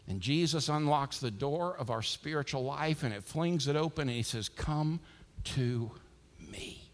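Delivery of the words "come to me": slow, spaced words sinking low and soft; gentle invitation. Acted. Jesus calling people through the open door, gentle invitation. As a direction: beckon softly, one word at a time.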